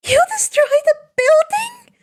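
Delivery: very high giddy question; thrilled disbelief. A narrator's reading. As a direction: squeal it in delight. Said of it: "her eyes sparkling".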